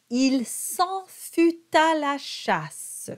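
slow, careful French: fut with a rounded U; French model line. Shown as a demonstration. Coach models the French line slowly for singers. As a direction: spell out each word of the line slowly. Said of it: "Fut is a U sound".

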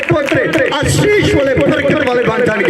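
rapid-fire proclamation with hardly a breath; fervent preaching. Real, not acted. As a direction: preach it in a rising, driving rhythm to rouse amens. Preaches declarations at full force. (ਜੋਸ਼ੀਲਾ ਪ੍ਰਚਾਰ)

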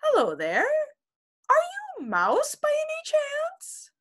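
very high, eager kitten. Acted. Kitten Max calls up to the birds, very high. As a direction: ask up into the tree as a small kitten.

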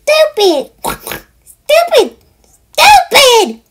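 squealing piglet. Acted. Dreaming piglet crying out, shrill and loud. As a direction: squeal each word like a frightened piglet.

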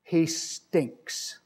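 soft, deadpan sarcastic aside; dry sarcasm. Retold or imitated. Disciple answering Jesus about Lazarus, deadpan sarcasm. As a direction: say it dry, as if it's obvious. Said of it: "this is dripping with sarcasm".

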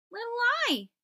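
letters calling out. Acted. Little letters greeting their friend, high-pitched and excited. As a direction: call his name out high and bright. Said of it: "they said".

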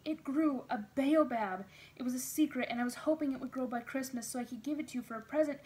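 put-on high, loud, eager child blurting news; excited outpouring. A narrator's reading. Child showing Daddy the surviving baobab, excited outpouring. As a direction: gush it out in an excited rush.

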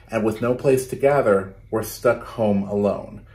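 put-on lively, rhythmic read-aloud for kids; storytelling to children. Teacher reading a picture book to her class, lively read-aloud. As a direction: bounce the rhymes for a class of children.

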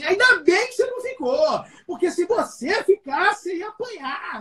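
mock scolding mother. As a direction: play the annoyed mum relieved he didn't stay, rising high and shaky. Mother scolding him in the car.

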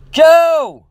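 high, loud alarmed scream of a name. A narrator's reading. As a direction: scream out for him urgently. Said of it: "I screamed".

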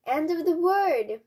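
high, loud lament in storytelling; mournful cry. A narrator's reading. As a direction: wail it out like doom has come. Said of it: "mourned Ducky Lucky".

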